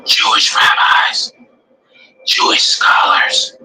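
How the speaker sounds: slow emphatic whisper. Real, not acted. Preacher whispering the names to his listeners, slow. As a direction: lean in and whisper it slowly.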